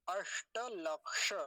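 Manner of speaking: slow अष्टलक्ष, retroflex sh stretched; retroflex sibilant demo. Shown as a demonstration. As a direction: sound ash-ṭa lak-sha in अष्टलक्ष fully. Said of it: "फिर से सुनिए".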